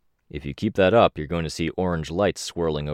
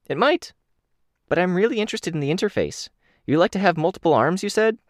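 higher, eager Kenzie voice; bright eager kid character, higher; 13 semitones higher than usual. Acted.

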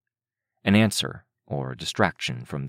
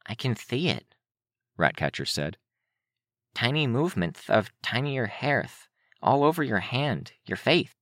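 lightly voiced odd girl; 7 semitones higher than usual. Ratcatcher voiced in story.

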